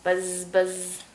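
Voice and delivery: low buzz with held z's; droning "buzzz, buzzz" bee noise. Shown as a demonstration. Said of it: "What's a bee say?".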